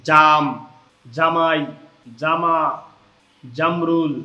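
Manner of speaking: firm, hard "j" at the start of জাম, জামাই, জামা, জামরুল; word list with a strong Bengali "j"; hard jim. Shown as a demonstration. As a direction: hit the জ in জাম firmly. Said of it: "জিম উচ্চারণ হয় শক্তভাবে".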